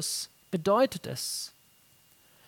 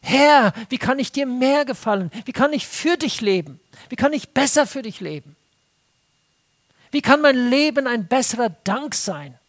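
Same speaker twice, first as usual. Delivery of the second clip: eager prayer; 4 semitones higher, 5 dB louder than usual. Retold or imitated. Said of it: "die Betonung".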